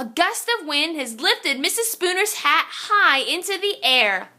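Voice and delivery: plain reading voice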